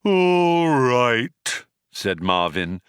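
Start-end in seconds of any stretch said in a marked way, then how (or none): 0.0-1.7 loud, long, doleful drone; high, booming robot lament; tolling bell. A narrator's reading. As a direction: toll it out like a great cracked bell. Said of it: "like the tolling of a great cracked bell".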